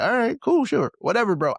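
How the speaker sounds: high, loud brush-off; dismissive. Retold or imitated. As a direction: wave him off, bored. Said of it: "And I'm just like".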